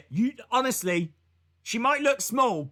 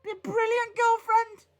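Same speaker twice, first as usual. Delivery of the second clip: mock excited; 13 semitones higher than usual. Mock enthusiastic repeat.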